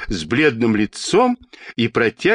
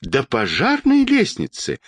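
high-pitched outraged woman's cry; mildly shouted outrage; indignant cry; 9 semitones higher than usual. A narrator's reading. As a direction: exclaim in high-voiced indignation, without a full shout. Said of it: "закричала высокая тётя".

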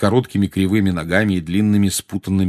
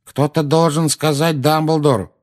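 higher, drawn-out plea; slow, raised-voice protest; protesting rogue; 8 semitones higher, 4 dB louder than usual. Acted. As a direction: plead your way out. Man protests while being beaten.

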